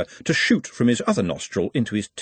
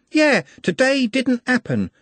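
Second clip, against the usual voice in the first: put-on high, girlish voice, lovestruck; high girlish voice, dreamy; dreamy young girl; 3 dB louder than usual. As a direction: sound like a smitten young shopgirl. Juliet's voice.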